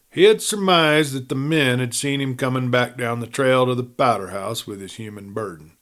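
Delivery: plain reading voice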